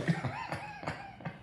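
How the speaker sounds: high, light laugh trailing off; real laugh. Real, not acted. Laughing at own story of the quarrel, amused. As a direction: laugh helplessly at the absurdity.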